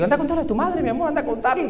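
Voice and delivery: sweet dad. Retold or imitated. Father sending daughter to tell mom, sweetly. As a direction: coax her sweetly, mock innocent.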